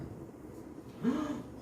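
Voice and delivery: sharp high breathless gasp of amazement; astonished gasp. Acted. Villager gasping at a neighbor's hundredfold harvest, astonished. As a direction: gasp as if you can't believe your eyes.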